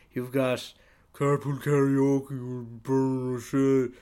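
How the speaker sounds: slow, loud, hammed-up pitch; lightly mocking a salesman who thinks his idea is genius; mock hype salesman. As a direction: sell it like a cheesy huckster.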